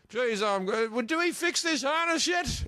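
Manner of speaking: high, careless Aussie bloke voice; mock Australian bloke. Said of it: "an Australian bloke that's going".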